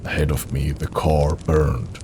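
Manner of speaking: usual speaking voice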